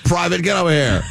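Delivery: barking drill sergeant, laughing. Retold or imitated. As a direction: call the private over like a sergeant. Sergeant calls private over.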